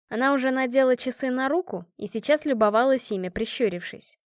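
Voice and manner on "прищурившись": plain reading voice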